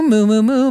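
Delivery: playful cow mooing; quick cartoon moos. Shown as a demonstration. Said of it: "moos and stuff".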